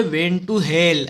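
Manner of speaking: slow, drawn-out mnemonic; memory-phrase demo. Shown as a demonstration. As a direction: stretch out each word for memorising. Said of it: "ऐसे बोल के याद रखो".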